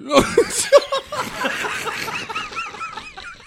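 squealing high laugh; high helpless giggle. Real, not acted. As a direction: giggle fit, can't stop. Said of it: "Du weißt, was ich meine".

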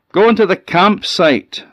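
called back high and loud; calling back. A narrator's reading. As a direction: call back over the distance. Death calls back.

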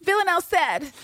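high mock tattling; hammed-up, high-pitched protest; petulant insistence. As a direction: imitate a whiny appeal to authority. Mimics Constantine demanding his package.

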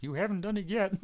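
high puppet voice; squeaky little green hand voice; Yoda voice. Acted. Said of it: "And he goes".

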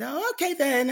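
high, loud sing-song acted reply; baffled brush-off. Puzzled manager answers before wandering off, baffled brush-off. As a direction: play a puzzled boss backing away.

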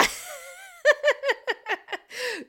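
loud, high-pitched cackle; long high laughing fit; burst of laughter. Real, not acted. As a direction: crack up at your own joke. Cracks up at own accent.

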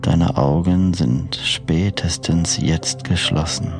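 soft, slow sleep meditation voice; guided meditation. Acted. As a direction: lull the listener toward sleep gently. Freigesprochene Einschlafmeditation.

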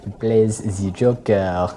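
caricatured French waiter; cartoon-French self-introduction; hammed-up French accent. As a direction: put on a comic French accent. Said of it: "put on a racist French accent".

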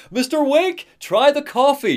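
high, hearty hail to a customer; Rusty the park ranger. Acted. Park ranger urging coffee on Mister Wake, high and eager. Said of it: "but Rusty's like".

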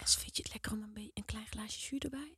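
tender hushed whisper to a patient. Pretend. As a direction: whisper gently like a nurse. Pampering the sick one, whispered.

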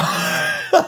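a high, unvoiced burst of laughter; breathy laugh. Real, not acted. Exasperated about downvotes on perfect stock, breathy laugh.

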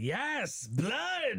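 high, drawn-out cartoonish cheer; put-on high cry of savage glee; mock bloodthirsty cry. Podcaster mimicking an exec thrilled by layoffs, mock bloodthirsty cry.

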